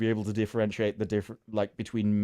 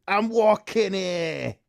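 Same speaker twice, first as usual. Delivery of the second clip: slow, higher New York cabbie voice; New York accent; 8 semitones higher, 3 dB louder, much slower than usual. Retold or imitated. Her favorite accent line, in a mock New York accent. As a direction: drag it out like a New York tough. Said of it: "my favorite accent line".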